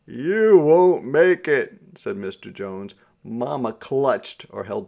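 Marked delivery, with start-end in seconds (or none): 0.1-1.8 loud, high, drawn-out warning; emphatic warning. A narrator's reading. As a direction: warn them slowly and forcefully. Passenger warns worried mother in story.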